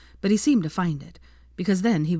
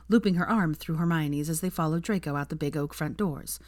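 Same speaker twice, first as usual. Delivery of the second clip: ordinary delivery, nothing marked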